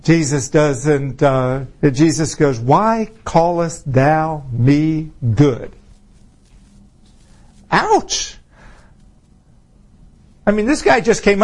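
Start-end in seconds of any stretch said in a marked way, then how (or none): 2.6-5.7 very slow, weighty quotation; measured rebuke. Retold or imitated. Jesus answering the ruler's greeting, measured rebuke. 7.7-8.4 high-pitched mock wince; mock pained. Preacher reacting for the rebuked ruler, mock pained.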